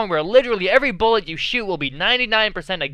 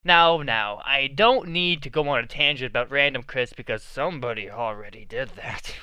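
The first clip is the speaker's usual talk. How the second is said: ordinary delivery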